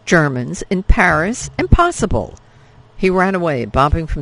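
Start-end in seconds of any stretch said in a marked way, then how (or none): 0.1-2.3 scoffing disbelief. Acted. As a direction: laugh off the rumour as absurd. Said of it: "Isabel scoffed".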